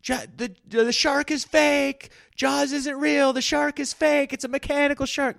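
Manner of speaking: hammed-up pedantic moviegoer; mock know-it-all heckler. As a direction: pretend heckler correcting the film, high mock voice. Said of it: "you yell out in the theater".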